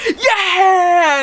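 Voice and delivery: high, drawn-out cartoon yeah; mock cheer. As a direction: imitate an eager battle cry. Voices cards cheering at command.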